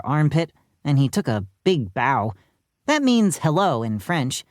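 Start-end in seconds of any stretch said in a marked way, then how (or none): none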